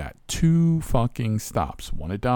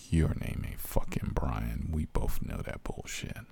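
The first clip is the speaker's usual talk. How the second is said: a low, gruff put-down of a fake name; low gruff retort; 9 semitones lower, 6 dB quieter than usual. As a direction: drop low and growl the retort. Driver growls at fake caller.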